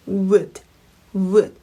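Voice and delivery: isolated "would", low-pitched, with a rounded w at the start; low, separate "would" with a clear w-glide; rounded-lip would. Shown as a demonstration. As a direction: model "would" word by word, lips pursed as for "will". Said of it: "이렇게 한번 해보실까요".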